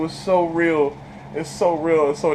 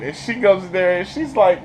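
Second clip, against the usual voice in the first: ordinary talk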